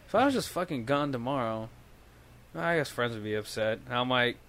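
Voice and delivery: hushed, low-energy confession to himself; soft, heavy musing, kept down; deep, brooding talk. Retold or imitated. Buzzed man musing alone after work, deep and quiet. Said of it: "started getting, like, deep".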